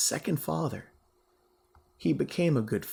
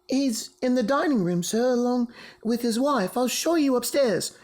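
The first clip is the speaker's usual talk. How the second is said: put-on higher, eager young maid; girl's voice; 9 semitones higher, 4 dB louder than usual. A narrator's reading. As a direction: chirp it like a young servant girl. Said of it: "said the girl".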